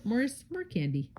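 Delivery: usual speaking voice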